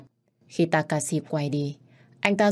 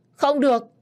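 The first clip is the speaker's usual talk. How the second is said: much higher, much louder cry of no; desperate shout; 10 semitones higher, 8 dB louder than usual. Acted. The old boss begging his son not to kill his brother, shouting. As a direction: burst out in a desperate refusal.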